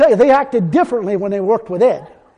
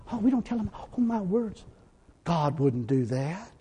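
much softer, lower, prissy mock of timid churches; mocking hushed voice; 4 semitones lower, 13 dB quieter than usual. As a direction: hush it like a squeamish churchgoer.

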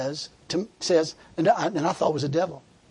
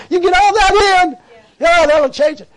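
loud, high-pitched sarcastic outburst; hammed-up, high and loud ridicule; mocking sarcasm; 10 semitones higher, 9 dB louder than usual. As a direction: cry it out in mock approval. Mocking rote penance as useless.

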